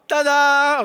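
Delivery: high, loud ta-da; window sound. Shown as a demonstration. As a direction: sing out a bright showman's ta-da. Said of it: "do the window sound".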